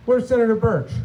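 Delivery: usual speaking voice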